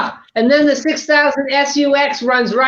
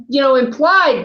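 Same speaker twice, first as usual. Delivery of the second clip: ordinary delivery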